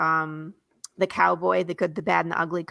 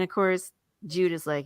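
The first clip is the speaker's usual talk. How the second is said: ordinary delivery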